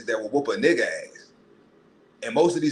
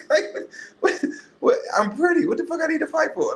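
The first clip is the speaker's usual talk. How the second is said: high airy laughing mock girly voice, much higher; vain pretty girl. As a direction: lampoon a vain girl who thinks she's too pretty to fight.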